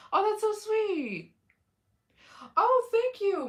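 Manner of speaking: high, drawn-out, loud coo; gushing thanks. Real, not acted. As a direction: squeal it sweetly, stretching the words. Gushing thanks for a viewer's compliment.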